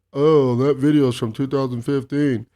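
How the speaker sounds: put-on mocking quote of a killjoy. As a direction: parrot the nitpicker in a mocking voice. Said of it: "gotta do the mocking voice".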